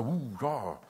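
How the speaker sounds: lightly mocked sheepish excuse; hesitant excuse. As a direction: hem and haw sheepishly. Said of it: "wie hast du eigentlich in deinem Gebetsleben".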